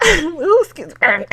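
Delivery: ordinary talk, nothing marked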